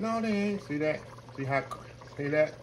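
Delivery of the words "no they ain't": high, doubtful mock retort; lightly voiced scoffing doubter; doubting viewer. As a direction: play the sceptic who says the peas are mushy. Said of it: "they said".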